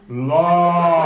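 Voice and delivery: mock-loud, drawn-out LAW; booming projection. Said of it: "you project really well".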